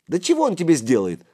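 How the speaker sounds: high, quick, loud challenge; fiery, dismissive outburst; hot-headed cry. A narrator's reading. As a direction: cry out hotly like a small boy. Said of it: "запальчиво крикнул мышь".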